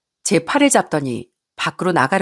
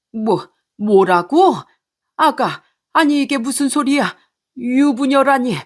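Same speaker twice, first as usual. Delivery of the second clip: shocked father; 6 semitones higher than usual. Acted. Father learns she is married.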